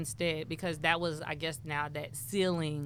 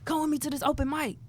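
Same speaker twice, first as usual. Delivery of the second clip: higher, coaxing voice; friend inviting; 8 semitones higher than usual. Retold or imitated. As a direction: coax her along like the friend. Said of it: "He was just like".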